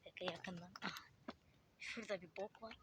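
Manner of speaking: hushed whisper to the camera. Real, not acted. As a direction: whisper it so nobody hears. Sneaking up on the house.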